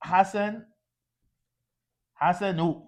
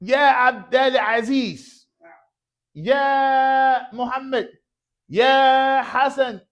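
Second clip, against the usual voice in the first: raised, high calls across a room; calling out names; 7 semitones higher, 3 dB louder than usual. Shown as a demonstration. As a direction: call each name out to someone far away. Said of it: "لابد علينا أن نقول عندما نستعمل يا". (نداء بصوت عالٍ)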